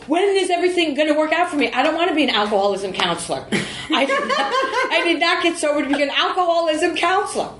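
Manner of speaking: high, fast, mock-whiny plea; whiny complaint. Sharing at an AA meeting, mock whining and laughing. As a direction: whine like a frustrated newcomer.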